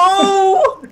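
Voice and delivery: high startled yelp; startled cry. Real, not acted. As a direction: yelp in shock. Startled at gross scene.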